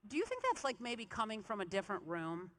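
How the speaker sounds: disbelieving question. Retold or imitated. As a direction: act out grasping at another explanation. Disbelieving question to doctor at scan.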